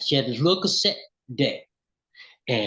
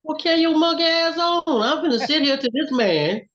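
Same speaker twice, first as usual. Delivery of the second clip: mock youthful retort; 13 semitones higher, 3 dB louder than usual. Young self snapping at friend.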